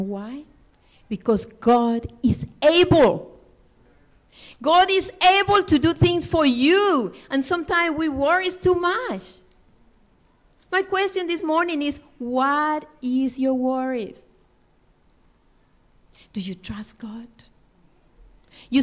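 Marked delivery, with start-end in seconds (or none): 1.1-9.4 preaching. Acted. Preaching at full force. 16.3-17.3 whispered, intimate question. Acted. Hushed question to congregation.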